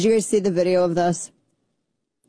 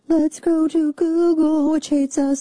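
silly singsong voice; 10 semitones higher than usual. Pretend. Announcing a Google search that hates conservatives, silly singsong voice. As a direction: go high and silly, stretching the words.

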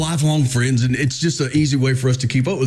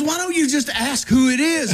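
much higher-pitched wife imitation; exasperated wife; 12 semitones higher than usual. Wife asking husband why he won't just ask, exasperated and high. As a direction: put the obvious question as the baffled wife. Retold wife challenging him.

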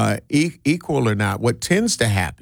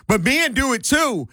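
high-pitched mock objection; put-on facetious protest, pitched way up; facetious retort; 10 semitones higher than usual. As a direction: imitate a flippant, deflecting comeback. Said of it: "said in a facetious type manner".

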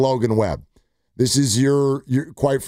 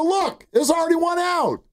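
mock outburst. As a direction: retell it as an exasperated high yelp. Acts out yelling back at friend.

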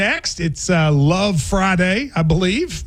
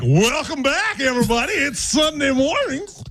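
am radio DJ; 4 semitones higher than usual. Retold or imitated. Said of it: "you sound like you're gonna be like".

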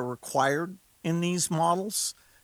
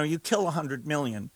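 normal talking voice

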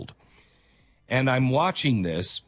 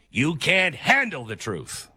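slow, higher, emphatic movie-courtroom outburst; Jack Nicholson; 4 semitones higher than usual. Acted. Host doing the film line on truth, dramatic impression. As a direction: draw it out like a famous film tirade. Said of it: "I'm turning into Jack Nicholson".

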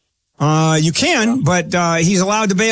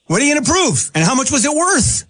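exasperated; 8 semitones higher than usual. Real, not acted. Host presses caller on proof.

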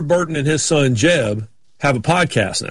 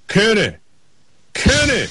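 imitated drawled call, pitched up; higher, stretched call, imitating a driver; Ward Burton; 6 semitones higher, slower than usual. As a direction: call out a name in a mock Southern drawl. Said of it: "all of a sudden I hear".

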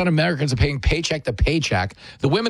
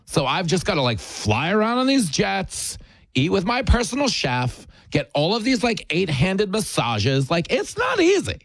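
mock spoiled princess; 3 semitones higher than usual. Mocking Meghan's complaint to the audience, pampered whine. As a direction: play the spoiled princess complaining about jets.

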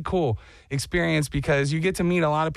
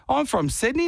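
higher put-on Australian; fake Australian accent; 7 semitones higher, faster than usual. He repeats his lie to strangers, fake Australian accent. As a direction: claim Sydney in a bogus Australian accent. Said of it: "why couldn't you just be you".

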